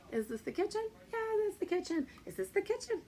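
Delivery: high, sing-song question and answer; playful quizzing. Shown as a demonstration. Teacher models a yes-or-no question for kids, playful high voice.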